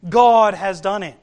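sudden loud proclamation; loud praise. Retold or imitated. Cleansed leper praising God, loud. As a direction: declare it forcefully, acting out praise. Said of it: "he praised God and said".

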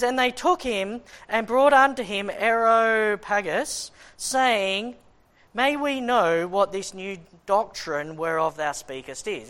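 measured, declaiming King James reading; scripture reading. Acted. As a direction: read it out like holy writ. Reads scripture aloud.

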